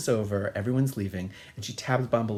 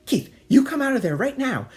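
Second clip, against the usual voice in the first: high, loud scolding order; mock angry mother; 14 semitones higher, 8 dB louder than usual. Mother ordering son out of bathroom, mock angry. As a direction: imitate a mother yelling for her son.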